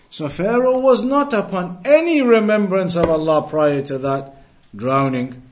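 ordinary talk, nothing marked

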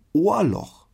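Ohrloch called out high and loud, stretched. Shown as a demonstration. Telling German listeners how to order fries in Holland, Ohrloch loud and high. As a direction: sound out Ohrloch like a Dutch order. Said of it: "Ohrloch, so wie das Loch im Ohr".

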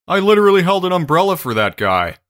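exasperated agent. Retold or imitated. Imagined agent quote, joking.

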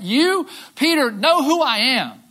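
Jesus' proclamation. Retold or imitated. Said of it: "Jesus speaking to Peter".

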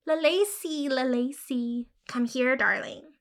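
croaking mockery. Matilda mocking the girl's call to the cat. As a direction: mimic her cruelly in a croak. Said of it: "croaking, mocking".

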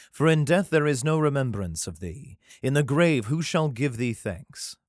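steady, solemn reading of a psalm; scripture reading. Acted. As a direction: read it like a Bible passage. Reads the psalm aloud.

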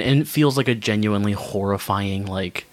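ordinary delivery

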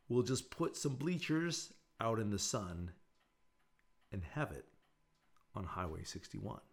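lightly dramatic lyric reading; poem reading. Acted. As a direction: read the verses like a poem. Reads [name] lyrics aloud.

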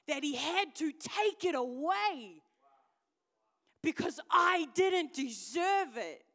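loud, high, drawn-out testimony; impassioned preaching. Real, not acted. Preacher confessing shame to the congregation, impassioned. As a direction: preach it at full force, stretching the words.

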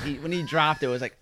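normal talking voice

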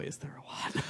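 hushed, breathy aside; whisper. Real, not acted. Whispered aside to cohosts about reused footage. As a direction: drop to a whisper for the punchline.

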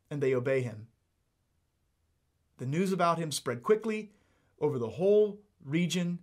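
normal talking voice

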